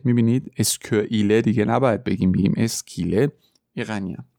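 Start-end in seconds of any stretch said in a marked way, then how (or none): none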